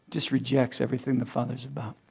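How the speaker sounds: normal talking voice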